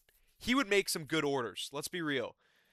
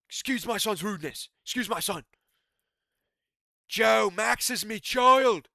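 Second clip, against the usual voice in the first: a cocky Irish brogue, stretched out; McGregor impression; 6 semitones higher, 7 dB louder than usual. The fighter apologising for his son, in mock Irish accent. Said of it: "I've lost my McGregor impression".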